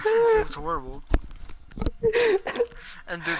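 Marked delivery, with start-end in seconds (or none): 0.0-1.0 high, laughing through the words; laughing horror. Real, not acted. Laughing as he recalls the classroom chaos, laughing through it. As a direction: say it while still laughing. Laughing about chair incident.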